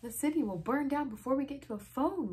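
high, loud panicky cry; shrieking. A narrator's reading. Reader voicing a girl fearing the town will burn, shrieking. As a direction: raise the alarm in a storybook shriek. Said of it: "Liza shrieked".

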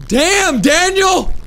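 high loud meme yell. Real, not acted. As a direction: belt it out. Yelling meme phrase.

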